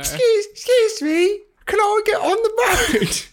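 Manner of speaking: put-on squeaky, very high little-child voice; slow falsetto child, hammed up; pretend child. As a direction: plead like a small Edwardian urchin. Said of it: "pass for a child in nineteen twelve".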